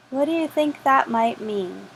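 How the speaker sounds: ordinary delivery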